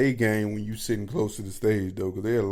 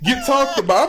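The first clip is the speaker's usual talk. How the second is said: higher, louder incredulous complaint; exasperated retelling; 10 semitones higher, 4 dB louder than usual. Retold or imitated. Complaining to the audience about the friend, higher exasperated. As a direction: vent in disbelief at the friend.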